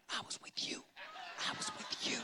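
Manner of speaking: silent mouthed whisper. Retold or imitated. Husband mouthing an alibi behind wife, whispered. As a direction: mouth it behind her back, barely a breath.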